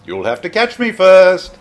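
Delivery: playful taunt. Acted. Taunting the Dalek.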